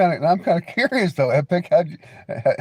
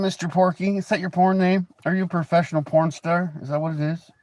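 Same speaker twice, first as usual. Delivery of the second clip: ordinary delivery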